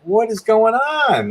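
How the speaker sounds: high, drawn-out disbelief; bewildered. Retold or imitated. Storyteller relives being taken to the middle of nowhere, bewildered. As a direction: re-enact slow, high-pitched bafflement.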